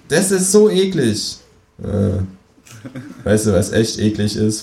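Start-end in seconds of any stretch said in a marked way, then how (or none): 0.1-1.4 high, cheeky kangaroo voice. Acted. To the narrator at dinner, high cheeky kangaroo voice. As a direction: pipe up as the smug kangaroo.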